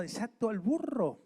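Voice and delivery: "burro" más agudo y fuerte, cayendo al final; empújalos a decirlo con fuerza; más fuerte y enérgico. Shown as a demonstration. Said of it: "Vamos, vamos". (más alto, con ánimo, "Desato al burro")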